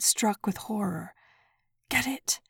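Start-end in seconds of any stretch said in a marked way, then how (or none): 1.9-2.4 breathing quickly. A narrator's reading. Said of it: "breathing quickly".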